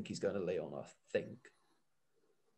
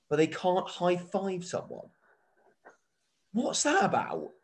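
high-pitched, incredulous rant; baffled exasperation; 6 semitones higher than usual. Real, not acted. Podcaster questioning the ban on high fives, exasperated. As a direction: protest it like it makes no sense at all. Ranting at a baffling celebration rule.

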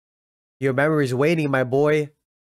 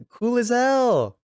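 admiring drawl; 10 semitones higher, much slower than usual. Real, not acted. Admiring character.

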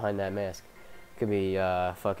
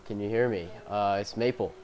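normal talking voice

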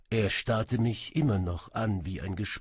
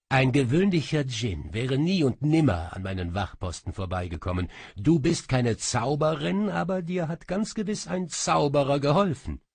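raised, higher voice of an accusing king; king pressing Asmira, higher and louder; king's accusing voice; 6 semitones higher, 4 dB louder than usual. Acted. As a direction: press her hard, as the king.

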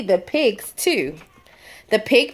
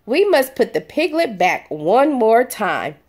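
plain reading voice

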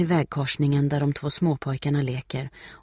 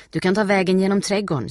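higher, louder, quick offer; helpful neighbour woman; 5 semitones higher, 7 dB louder, faster than usual. A narrator's reading. Neighbour helping Steffi search, quick and helpful. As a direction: offer the shortcut readily.

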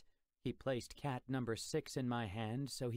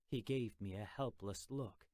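plain reading voice

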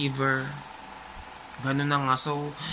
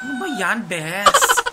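exasperated outburst; 6 semitones higher, 9 dB louder, slower than usual. Real, not acted. Frustrated outburst at broken product.

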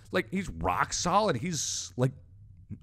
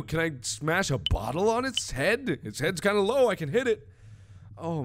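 usual speaking voice

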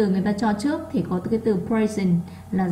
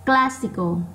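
CLAS in "classical" higher, then falling; stressed first syllable; 4 semitones higher, slower than usual. Shown as a demonstration. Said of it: "nó to hơn những âm còn lại". (nhấn mạnh âm một của "classical")

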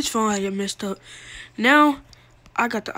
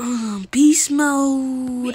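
drawn-out shout-out; 3 semitones higher, 3 dB louder, much slower than usual. Pretend. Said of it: "shout out to".

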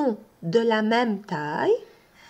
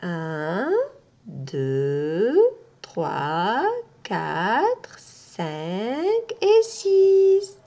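drawn-out count; 2 semitones lower, much slower than usual. Shown as a demonstration. Teacher counts the I letters with the class. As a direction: stretch the last number. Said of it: "counting the I".

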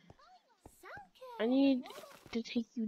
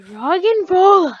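loud, higher-pitched exclamation; loud callout; 7 semitones higher, 14 dB louder than usual. Real, not acted. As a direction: call out the enemy loudly. Startled callout on seeing enemies.

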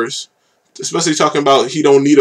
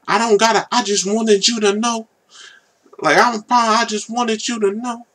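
imitating the rapper's hook; 10 semitones higher than usual. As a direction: mimic his hook, pitched way up and hushed. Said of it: "He's like".

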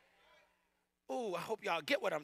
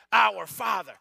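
shouted prayer; 12 dB louder, slower than usual. Retold or imitated. Recalling prayer, shouted quote.

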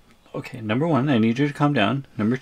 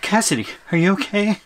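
worried call; 8 semitones higher than usual. Acted. Shouting to girlfriend in jar.

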